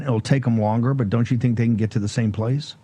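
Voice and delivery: ordinary delivery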